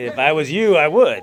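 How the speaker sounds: loud, high mock yell at the wife; mock shout. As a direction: belt it out as a joke. Retold reply to wife, shouting.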